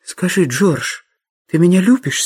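plain reading voice

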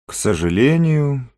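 regretful, lingering delivery; saddened regret. A narrator's reading. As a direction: draw the words out with a touch of sorrow. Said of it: "грустно сказал Марамбль".

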